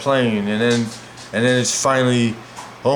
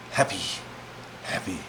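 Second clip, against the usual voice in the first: softer, dying away each time; fading repetition; 7 semitones lower, 13 dB quieter than usual. Retold or imitated. Survivor recalls relief after crash, fading softer. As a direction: let the word fade out, softer.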